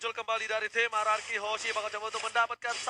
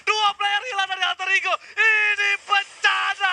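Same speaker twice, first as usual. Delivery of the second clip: hype shout; 6 semitones higher, 3 dB louder, slower than usual. Real, not acted. Team wipe call in casting.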